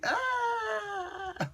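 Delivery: high, sustained mock scream; thin falsetto scream, played for comedy; mock yell. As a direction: let out a long, high-pitched "aah" without much volume. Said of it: "one of them's yelling".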